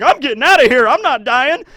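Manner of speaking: a hammed-up scared foot soldier; mock panicked soldier. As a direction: blurt it while running off.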